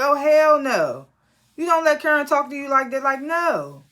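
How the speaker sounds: indignant scolding; outraged. Retold or imitated. Outraged at Karen's zip it.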